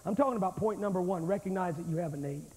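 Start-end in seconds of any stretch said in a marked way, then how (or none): none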